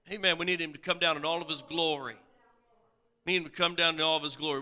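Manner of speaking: usual speaking voice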